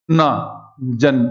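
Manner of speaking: 'ना, जन' said very slowly, syllable by syllable; very slow 'ना, जन', final न with no vowel; slow syllable split. Shown as a demonstration. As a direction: break it into 'ना' then 'जन', stopping dead on न. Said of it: "ना कहते ही अंत हो जाता है".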